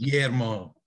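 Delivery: Guillermo lightly in a Spanish accent; playful Spanish-accented pronunciation of the name. Shown as a demonstration. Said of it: "when you say it with an accent".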